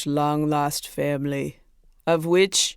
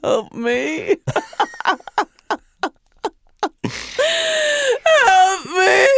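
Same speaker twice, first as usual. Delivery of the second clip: high, laughing-crying plea; mock desperate wail; much slower than usual. Comedian begging fans to save her album, mock desperate wail. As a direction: wail for help in hammed-up despair. Mock breakdown, pleading for help.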